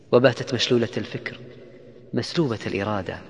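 usual speaking voice

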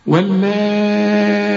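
واللائي held long and slowly; long madd. Shown as a demonstration. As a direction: stretch the ا of واللائي. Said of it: "برضو خدناه".

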